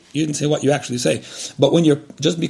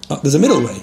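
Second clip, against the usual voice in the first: normal talking voice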